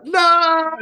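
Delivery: very high, loud "No"; shocked no. Retold or imitated. Reacting to being fired as a joke, high shocked cry.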